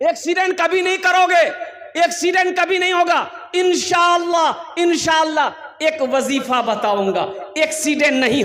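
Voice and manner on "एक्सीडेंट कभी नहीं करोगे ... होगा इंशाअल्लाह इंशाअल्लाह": high, emphatic promise to a crowd; preacher's proclamation. Acted. Preacher promises the crowd safe travel, proclaiming. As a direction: declare it forcefully in a raised voice.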